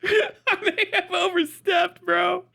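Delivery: very high, squeaky laughing voice; helpless laughing. Real, not acted. Streamer apologizing for overstepping, helpless with laughter. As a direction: squeeze the words out through real laughter.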